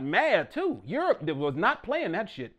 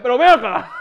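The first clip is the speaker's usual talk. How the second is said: loud, high Dominican exclamation; Dominican Spanish; 5 semitones higher, 17 dB louder than usual. Acted. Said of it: "Dominican like".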